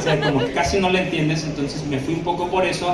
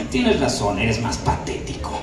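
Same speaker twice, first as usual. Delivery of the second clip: lower, softer, slow sneer; rude Félix; 8 semitones lower, 4 dB quieter, slower than usual. Shown as a demonstration. Félix insulting Adrian, low and slow. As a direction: put him down coldly. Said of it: "es mucho más grosero".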